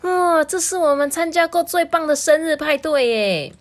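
high, loud cheer; delighted exclamation. A narrator's reading. Party guests agreeing on best party, excited cheer. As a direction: gush excitedly for the whole group. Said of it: "大家呢就一致认为说".

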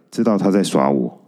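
plain reading voice

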